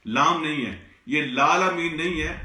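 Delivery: ordinary talk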